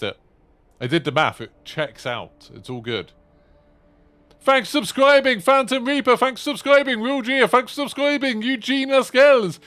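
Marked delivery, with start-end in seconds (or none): 4.4-9.6 very high, loud thank-you call; high-pitched streamer roll call; subscriber shout-out. Acted. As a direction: rattle off thanks in a pitched-up announcing voice. Thanks new subscribers by name.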